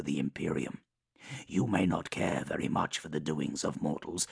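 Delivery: measured, menacing warlord voice; Mortarion voice. Acted. Mortarion presses Kugoth.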